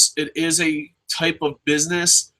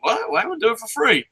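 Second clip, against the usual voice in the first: disbelieving; 8 semitones higher, faster than usual. Retold or imitated. Mock disbelief.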